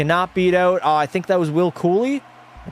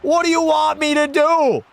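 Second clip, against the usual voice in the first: furious rant; 10 semitones higher than usual. Real, not acted. Gamer ranting after conceding a cheap goal, furious. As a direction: rage at the screen in disbelief.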